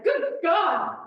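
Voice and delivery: sudden alarmed exclamation, pitch jumping; startled cry. Acted. Woman reaching into a stranger's bag, startled cry. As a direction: cry out in shock.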